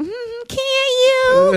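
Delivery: high, slow drawn-out mm; skeptical tease. Retold or imitated. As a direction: make him prove it, slowly. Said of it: "'cause I might be like".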